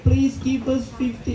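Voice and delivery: put-on high, pleading child's voice; guilt-trip them like an ad kid; pleading charity kid. Imitating a child in a charity ad begging for money, high and pleading. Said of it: "those commercial of this kid".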